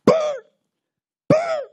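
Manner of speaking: sharp, very high and loud striking noise; mock strike sound. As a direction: crack it out like a blow. Imitating the rock being struck.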